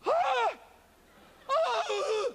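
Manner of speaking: high, loud gasping cry; shocked yelp. Retold or imitated. Cold water hits his feet, high startled yelps. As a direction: let out a sudden yelp of shock. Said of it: "I heard this noise".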